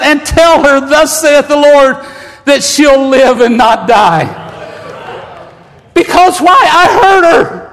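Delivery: preacher crying out the angel's promise to the congregation; full-force preaching. Acted. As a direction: proclaim it like thus saith the Lord.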